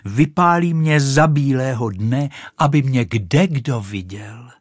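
loud proud delight; delighted exclamation. Acted. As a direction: crow with pride to be seen. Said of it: "zvolala Raketa". (hlasité nadšené zvolání)

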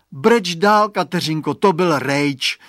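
loud, mildly disgusted call. A narrator's reading. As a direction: call it out slowly with some annoyance. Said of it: "řekl znechuceně Radovan".

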